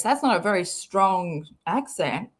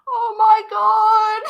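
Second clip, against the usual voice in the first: high, loud, slow cry through laughter; laughing exclamation; 11 semitones higher, 7 dB louder, slower than usual. Real, not acted. Reader overcome mid-sentence, loud high laughing exclamation. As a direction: squeal it slowly, helpless with laughter.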